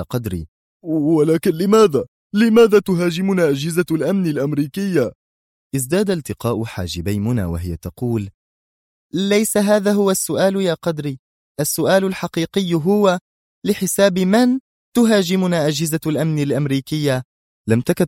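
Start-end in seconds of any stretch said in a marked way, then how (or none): 0.8-5.1 high, loud bewildered question; astonished. A narrator's reading. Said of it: "اتسعت عيونهم جميعا في دهشة". 9.1-17.2 high-pitched, pointed correction; tense insistence. A narrator's reading.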